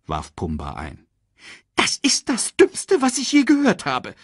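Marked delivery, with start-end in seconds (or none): 1.8-4.1 high, sharp meerkat outburst; snapping, hissed scolding. Acted. Meerkat rejecting warthog's idea, high scolding snap. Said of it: "fauchte Timon".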